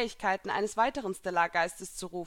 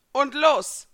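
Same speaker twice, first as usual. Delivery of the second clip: loud, raised starting shout; called out; 7 semitones higher, 10 dB louder than usual. A narrator's reading. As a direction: call the start of a fight. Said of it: "rief er".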